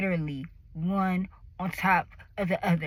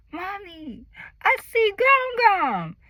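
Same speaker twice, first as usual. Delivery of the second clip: put-on high, slow toddler voice; child announcing she sees grandma; little girl voice; 10 semitones higher, 5 dB louder, slower than usual. Two-year-old telling her mommy, high toddler voice. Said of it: "my daughter is like".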